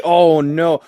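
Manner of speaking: ordinary talk, nothing marked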